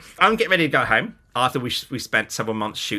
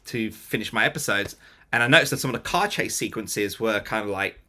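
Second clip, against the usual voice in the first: normal talking voice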